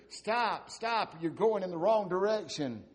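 high, loud call from a treetop; hollering a warning from above. Retold or imitated. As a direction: shout down to stop them. Leader hollering down tree.

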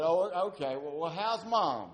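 lightly voiced wife moving on; the wife's voice. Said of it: "He said".

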